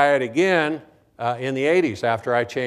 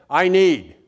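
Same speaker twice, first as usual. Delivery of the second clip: loud, high, demeaning demand; demanding, disrespectful; 5 semitones higher, 7 dB louder than usual. Retold or imitated. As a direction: imitate a rude student making demands. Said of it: "in a very demanding, demeaning, and disrespectful tone of voice".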